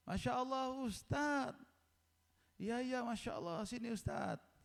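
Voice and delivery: high-pitched delighted welcome; cheerful sick man. Retold or imitated. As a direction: welcome an honoured guest in a high, happy voice. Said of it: "beliau ceria".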